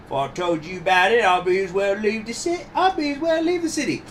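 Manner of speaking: Cockney witness, pitch climbing high; Cockney accent. Acted. Witness reluctant to inform on politicians, high Cockney. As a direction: rise into a high Cockney protest. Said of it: "this is the Cockney accent here".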